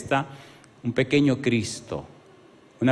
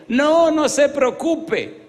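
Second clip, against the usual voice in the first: much higher, very slow, drawn-out woman's voice; Sonia, reassuring; 13 semitones higher, 5 dB louder, much slower than usual. Retold or imitated. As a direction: stretch "No, no se preocupe" high and slow to play Sonia reassuring. Pastor voices Sonia reassuring him. (Sonia tranquilizando al pastor, voz muy aguda y lenta)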